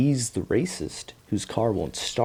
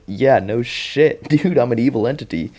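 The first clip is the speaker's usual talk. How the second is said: mock exasperation; 5 semitones higher, 6 dB louder than usual. Monster admitting he's evil, loud mock exasperation. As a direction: hit it loud and incredulous, playing it up.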